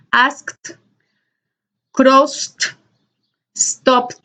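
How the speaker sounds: ASKED, CROSSED, STOPPED each ending in a spat T; tongue to upper teeth, pop the T ending asked, crossed, stopped; spat final T. Shown as a demonstration. Teacher models the T ending, spat T on asked, crossed, stopped. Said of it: "como si estuviéramos un poco escupiendo".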